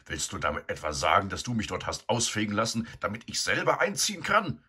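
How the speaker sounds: can't believe what he hears; incredulous. A narrator's reading. Eduard realizing he swept her shed for himself, incredulous. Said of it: "fragte Eduard ungläubig".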